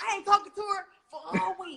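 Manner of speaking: high, pouty mock sulk; imitating a sulking teammate. Rau'shee sulking after the door slam, high mock pout. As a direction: play him offended and sulking. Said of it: "And he was like".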